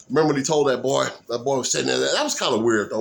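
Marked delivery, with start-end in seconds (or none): none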